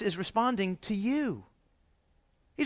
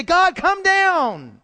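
pleading prayer; 4 semitones higher, 6 dB louder, much slower than usual. Retold or imitated. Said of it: "We look to heaven and say".

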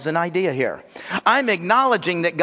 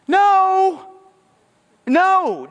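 emphatic shouted no; 12 semitones higher, 4 dB louder than usual. Real, not acted. Preacher rejecting "follow your heart.

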